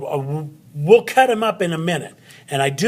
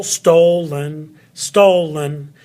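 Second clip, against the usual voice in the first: "stolen," with a loud, leaning first syllable; stress on first syllable; 5 dB louder, much slower than usual. Shown as a demonstration. Teacher showing where the accent falls, first syllable of "stolen," stressed. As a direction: hit the start of "stolen," hard, let the ending drop. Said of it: "The, the very first syllable".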